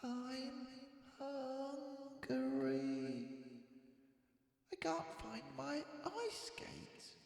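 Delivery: high, soft little voice; small complaining Boris. A narrator's reading. Sleepless cub calling to parents, high and soft. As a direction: fret quietly from bed.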